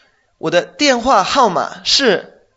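slow and loud, each digit spaced; careful teacher dictation of a phone number; slow model sentence. Shown as a demonstration. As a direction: dictate the number clearly to a learner. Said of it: "directamente diríamos".

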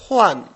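"Juan" as Chinese huan, falling sharply; teacher's clear falling "Juan", said as huan; soft H, fourth tone. Shown as a demonstration. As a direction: model "Juan" with an H for the student, pitch dropping. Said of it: "Con H".

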